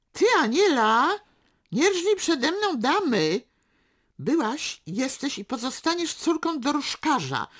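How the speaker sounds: high, loud mocking harangue; scornful tirade. A narrator's reading. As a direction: tear into her with mock-grand scorn. Father's scornful tirade at aunt.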